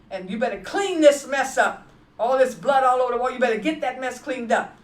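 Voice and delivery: loud, fast, harsh command; a retold abuser yelling; husband's angry shout. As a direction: bark it at her like a threat. Abusive husband voiced in story.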